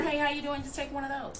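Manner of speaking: customer service voice. Shown as a demonstration. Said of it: "Did you hear me just do it?".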